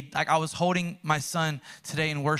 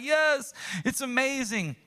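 high, elated exclamation; acted burst of joy at being loved; overjoyed; 8 semitones higher than usual. Believer feeling the father's embrace, overjoyed. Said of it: "and you feel love".

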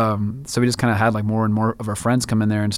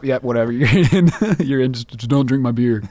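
laughing, easygoing wave-in; laughing pushover host; 2 semitones higher, 4 dB louder than usual. Pretend. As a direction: let them in, giggling. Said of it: "We'd just be like".

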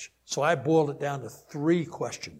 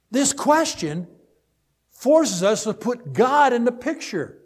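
much louder and higher, slow, ringing pulpit voice; full-force preaching; 9 semitones higher, 10 dB louder, slower than usual. Acted. Pastor counseling on anger proclaims God's sovereignty at full force. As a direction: thunder it out to the congregation.

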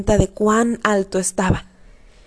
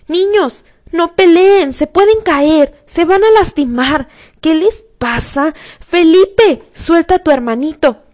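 frantic parents shouting, much higher and louder; hysterical yelling; 7 semitones higher, 6 dB louder than usual. A narrator's reading. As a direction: scream up at the fighting boys in panic. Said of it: "Mis padres vociferaban histéricos desde abajo".

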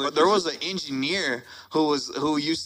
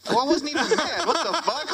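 incredulous; 9 semitones higher than usual. Retold or imitated. Retelling his reply to subliminal post.

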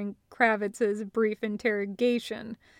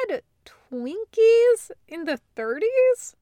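puzzled surprise; 10 semitones higher, 5 dB louder than usual. A narrator's reading. Barry frowning, puzzled question.

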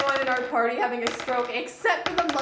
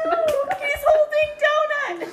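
high, slow, loud protest; alarmed protest; 7 dB louder, slower than usual. Real, not acted. Player stopping a friend's roll, high alarmed protest. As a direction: cry out no in alarm.

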